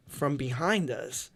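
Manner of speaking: normal talking voice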